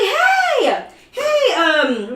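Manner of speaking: high, drawn-out friendly shout; calling out. Retold or imitated. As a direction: call out to a classmate, high and eager. Calls out to classmate in Walmart.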